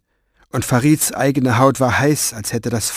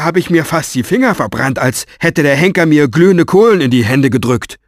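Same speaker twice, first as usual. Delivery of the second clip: higher, louder panicky rant; frightened and angry; 4 semitones higher, 7 dB louder than usual. A narrator's reading. Oz warns Orpheus about the fiery demon, loud and panicky. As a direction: babble your fear of the demon at full volume. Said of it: "Angst und Wut auf dem grobschlächtigen Gesicht".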